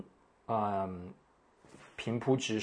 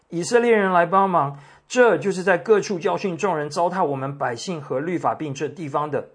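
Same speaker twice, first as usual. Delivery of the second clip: raised, higher and louder reading of a shout; crying out; 5 semitones higher, 6 dB louder than usual. A narrator's reading. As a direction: call out for help to the crowd, as a reader. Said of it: "喊叫说".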